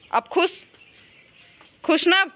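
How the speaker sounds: high, loud playful coaxing; teasing coax. Real, not acted. Coaxing Varsha after gifting bangles.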